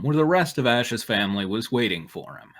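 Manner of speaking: ordinary delivery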